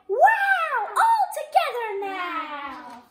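high, loud whoop, then slow; excited frog shout. A narrator's reading. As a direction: call everyone in with joy. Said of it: "Frog cried".

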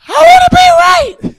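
high loud wailing cry; emotional cry. Retold or imitated. Man weeping at the altar, wailing. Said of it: "His voice emotional".

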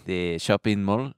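hesitant mock Israeli accent. Shown as a demonstration. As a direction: ask it as an Israeli hunting for the word. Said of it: "the Israeli accent is a lot like this".

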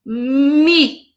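"Mi" alone, held long; strong syllable. Shown as a demonstration. Teacher answering her own question, "Mi" stressed and drawn out. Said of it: "Qual sílaba que foi a mais forte".

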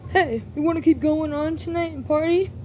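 a pushy bro begging you to keep partying. Acted. Party guy knocks at night.

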